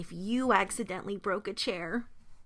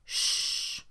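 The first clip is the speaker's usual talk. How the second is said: long unvoiced shush; hushing; 4 dB quieter than usual. A narrator's reading. Mama Bear comforting her Baby Bear, hushing. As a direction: shush a crying little one. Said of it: "Mama Bear comforted her Baby Bear".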